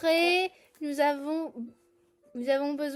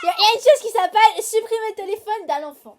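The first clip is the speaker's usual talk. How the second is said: shouting; 6 semitones higher, 9 dB louder, faster than usual. Acted. Teasing threat to player.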